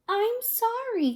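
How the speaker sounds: a tiny sorry creature pleading; little voice. A narrator's reading. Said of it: "came a little voice".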